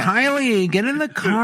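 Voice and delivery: high mock mom holler; soccer mom. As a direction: call a dawdling kid to the car. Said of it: "like a soccer mom right now".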